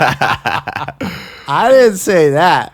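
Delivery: high, loud, drawn-out denial; loud protest. Real, not acted. Host disowning a friend's jab about the ex, loud protest. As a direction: protest loudly over the laughter. Joking protest over others' laughter.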